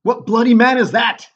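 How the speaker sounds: loud, high royal demand; King Duncan. Acted. King asks about a wounded soldier, loud and high. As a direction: call out the question as the king.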